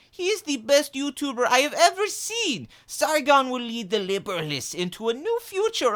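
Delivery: pompous, over-the-top caricature, pitch leaping up high; V impression. Impressionist as V addressing Captain Cummings, exaggerated high V voice. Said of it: "funnier V voice".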